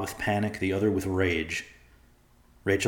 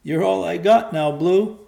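put-on high, loud tearful child voice; a crying boy clinging to his dog; 11 semitones higher, 9 dB louder than usual. A narrator's reading. Crying boy talks to his dog Blue, tearful. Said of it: "still crying as the rain came down".

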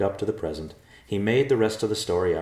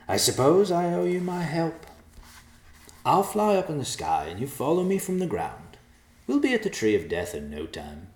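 high grand bird voice; pompous old vulture; creepy vulture croak; 9 semitones higher than usual. Acted. Vulture character speaking.

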